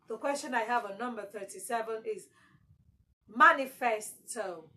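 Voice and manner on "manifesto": manifesto with a loud opening MA; MA-nifesto, falling after the first syllable; stress on MA. Shown as a demonstration. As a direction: hit MA hard in manifesto. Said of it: "So ma, your stress is on ma".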